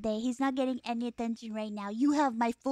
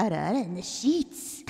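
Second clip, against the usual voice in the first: anime ara ara voice; 5 semitones lower than usual. Pretend. Teasing anime-style phrase.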